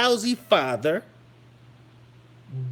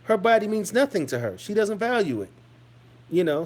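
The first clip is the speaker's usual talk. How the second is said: usual speaking voice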